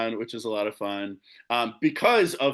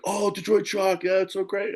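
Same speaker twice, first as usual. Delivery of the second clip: much higher, a gushing fan's voice; gushing fans; 9 semitones higher than usual. Retold or imitated. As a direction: play an enthusiastic fan. Fans at Fever games gushing.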